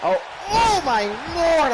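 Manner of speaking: high amazed oh my lord; astonished. Real, not acted. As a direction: blown away by the move. Astonished at the suplex.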